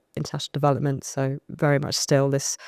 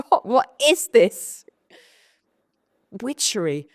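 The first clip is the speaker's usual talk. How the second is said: high, loud, slow marvelling; amazed disbelief; 7 semitones higher, 4 dB louder, slower than usual. Retold or imitated. Accepted by strangers, high drawn-out amazement. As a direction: stretch it out in delighted wonder.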